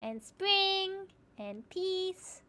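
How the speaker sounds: slow, high sing-song welcome; cute anime streamer. Acted. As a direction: stretch each word out sweetly. Welcoming named raiders slowly.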